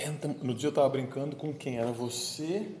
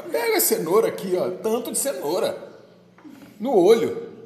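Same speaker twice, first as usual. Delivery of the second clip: imitated carefree cook; 7 semitones higher, 9 dB louder than usual. Débora's loose way of measuring.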